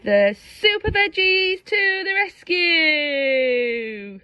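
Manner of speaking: slow, high triumphant call; drawn-out superhero fanfare; heroic announcement. A narrator's reading. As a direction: herald the heroes' arrival.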